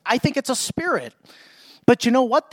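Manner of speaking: ordinary talk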